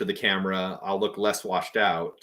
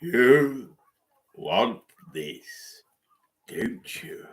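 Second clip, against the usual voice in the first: tempting devilish whisper; much slower than usual. Pretend. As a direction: tempt them like the Emperor. Palpatine cosplayer doing the voice.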